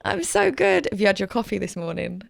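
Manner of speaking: normal talking voice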